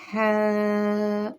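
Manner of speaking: हा breathed out gently; gentle Arabic ه; soft ha. Shown as a demonstration. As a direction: say हा soft and airy, not harsh. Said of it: "हा को नरमी के साथ".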